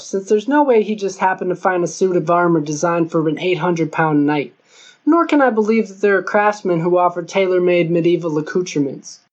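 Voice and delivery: usual speaking voice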